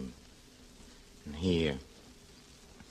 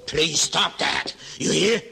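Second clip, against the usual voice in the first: higher, louder, slow and stern command; raised stern order to stop the humming; angry outburst; 10 semitones higher, 6 dB louder than usual. Acted. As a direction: cut her off hard and loud. Lodger snaps at humming landlady.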